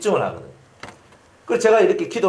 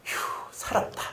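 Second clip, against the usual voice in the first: whispered sigh of relief; relieved whisper; 11 dB quieter than usual. Retold or imitated. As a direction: breathe it out, saved. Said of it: "이게 나오는 거예요".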